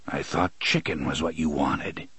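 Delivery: low, soft, dry gunslinger. A narrator's reading. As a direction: say it quietly and drily. Roland voiced low and soft.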